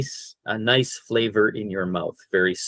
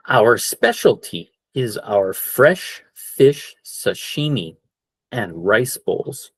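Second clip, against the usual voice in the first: slow model sentence; slower than usual. Shown as a demonstration. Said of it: "there's the word again".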